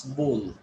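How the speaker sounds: "bowl" with an O sound, like bole; correct bowl. Shown as a demonstration. Teacher confirming the word, correct O in bowl. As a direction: say "bowl" to rhyme with goal. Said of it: "you have to pronounce it bowl".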